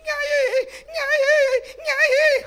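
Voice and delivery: put-on very high, loud baby wail; newborn wailing. Pastor mimicking a baby's first cry in the labour room, high loud wail. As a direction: cry out like a newborn just delivered.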